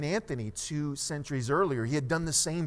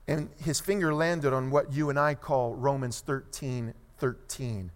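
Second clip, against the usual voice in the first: ordinary talk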